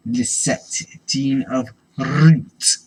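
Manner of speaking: slow, loud, booming name; grand announcer. Pretend. Dramatic card name reading.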